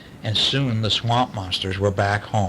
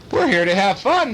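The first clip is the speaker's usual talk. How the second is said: ordering; 8 semitones higher, 4 dB louder than usual. A narrator's reading. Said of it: "Missus Piper ordered".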